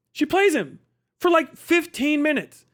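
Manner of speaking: delighted retelling of her win; excited. Real, not acted. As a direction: savor the triumph. Gleeful retelling of debate win.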